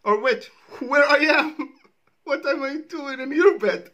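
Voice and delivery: loud, high, laughing through a retold panic; cracking up while acting out waking next to a stranger; retold wake-up shock. Reacting to a Walter joke, he laughs through his own shocked morning quote. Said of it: "I'm like".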